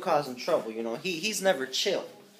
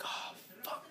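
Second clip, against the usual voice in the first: whispered oh fuck; 17 dB quieter than usual. Retold or imitated. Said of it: "so I'm just like".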